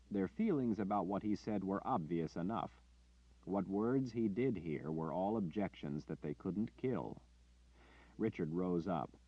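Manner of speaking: usual speaking voice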